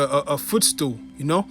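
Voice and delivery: normal talking voice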